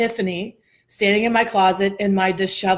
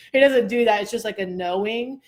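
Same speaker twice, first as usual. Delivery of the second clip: ordinary talk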